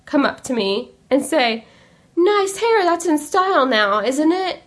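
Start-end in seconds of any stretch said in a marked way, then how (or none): 2.1-4.6 lightly voiced customer, pitched higher; higher-pitched retold comment; imitated customer remark. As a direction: mimic a customer commenting on hair. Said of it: "I actually had a customer".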